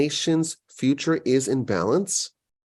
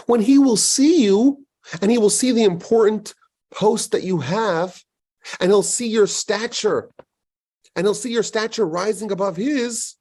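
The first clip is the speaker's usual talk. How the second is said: voicing God; 6 semitones higher than usual. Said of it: "God says".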